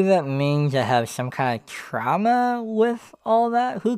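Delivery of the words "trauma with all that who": high, slow, dramatic tease; mock dramatic. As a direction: drag it out in a mock-ominous voice. Joking about his trauma.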